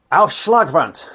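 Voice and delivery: usual speaking voice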